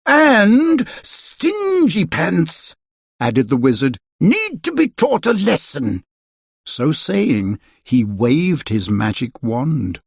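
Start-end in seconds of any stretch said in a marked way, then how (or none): 0.1-2.7 high, slow wizard voice; a fussy old wizard delivering judgement. A narrator's reading. Wizard voicing judgement on Mister Stingy. 4.2-6.0 put-on a fussy old wizard delivering judgement. A narrator's reading. Wizard voicing judgement on Mister Stingy.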